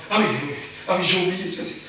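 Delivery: ordinary delivery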